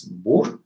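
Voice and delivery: "bort" with the r barely said, one rise then fall. Shown as a demonstration. Teacher demonstrating the Norwegian tune. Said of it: "it goes up only once".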